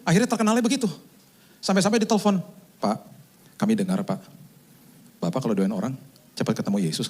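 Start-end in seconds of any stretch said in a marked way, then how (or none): 2.8-7.1 low, lightly voiced polite caller; caller's request. Family member phoning the pastor for prayer, lightly voiced request. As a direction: lightly imitate an earnest caller.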